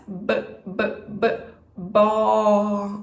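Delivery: phonics sounds "B, B, B, bó" pushed out; sounding out words. Shown as a demonstration. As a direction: model the letter sound "bó" to a child. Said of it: "com que letra que começa".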